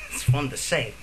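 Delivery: ordinary talk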